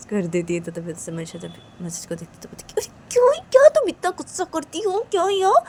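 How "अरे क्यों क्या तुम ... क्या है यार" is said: high, loud protest; exasperated. Acted. Friend asking the other why she gets so angry, high and exasperated. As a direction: push back in a raised, high voice.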